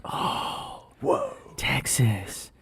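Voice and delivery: slow, hushed, awed whoa. Retold or imitated. Kid at school, amazed to meet a Texan. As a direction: drag out the amazement, almost whispered. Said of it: "He goes".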